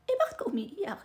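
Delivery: high, loud imitation of a challenge; acted-out confrontation, loud and high; retold pointed question. Retold partner confronting her crying.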